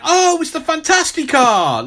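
mock excitement. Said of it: "not to the extent that I went".

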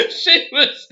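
laughing hard, high and loud; real laughter. Real, not acted. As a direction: break up laughing at the memory. Laughing at the girl's reaction.

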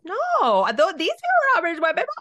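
put-on high, loud, quick kid answer; imitated daughter protesting. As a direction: lightly mimic a child blurting an answer. Acts out daughter conceding, corrected.

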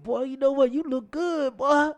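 high, loud teasing greeting; high mock praise for the friend; teasing compliment. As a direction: rib him with a loud compliment. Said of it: "I said".

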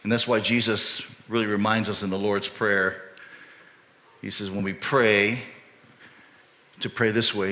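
normal talking voice

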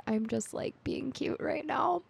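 soft, coy mock reply; Aragorn. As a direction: shrink the voice small and cutesy. Aragorn's coy answer, acted.

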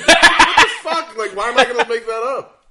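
loud, high-pitched laughing outburst; hammed-up exasperated yell; incredulous protest. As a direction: shout it in mock disbelief, half laughing. Retold exasperated protest at disbeliever.

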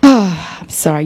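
ordinary delivery

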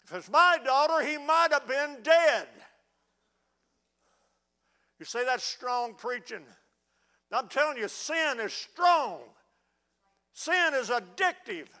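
loud, high preaching cry; thundering revival preacher; full-force preaching. Acted. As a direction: preach it hard and loud to the congregation.

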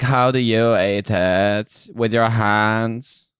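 very slow, drawn-out mock Germanic accent; Anna Delvey. [name] as a fake heiress asking about eating, Anna Delvey accent. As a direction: stretch each word in a hammed-up foreign accent. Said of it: "a real kind of Anna Delvey".

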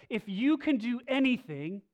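"If you can do anything" cried out loud and high; desperate plea. Retold or imitated. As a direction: plead with him, voice up. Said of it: "he comes to Jesus and he says".

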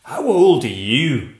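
startled by the tiny foe; a slow astonished gasp of a question; monster gasping. A narrator's reading. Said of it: "the monster gasped".

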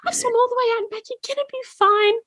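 calm her like a sweet granny; reassuring old woman. Retold or imitated. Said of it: "she's like".

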